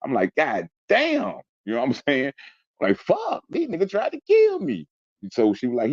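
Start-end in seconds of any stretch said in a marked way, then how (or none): 0.3-1.3 high, drawn-out retold exclamation; reenacted shock, pitched way up; stunned disbelief. Retold shock at wound count. 3.0-4.9 very high, slow retold exclamation; incredulous and drawn out, voice pitched way up; shocked outcry. Retold shock at being shot.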